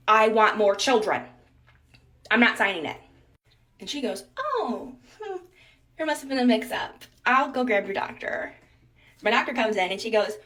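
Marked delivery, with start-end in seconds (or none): 0.1-3.0 loud insistent refusal. Retold or imitated. Patient to nurse, refusing to sign, loud firm insistence. 4.4-8.5 higher, falsely surprised nurse voice; mock surprised nurse. Nurse to patient, covering a mix-up, high mock surprise.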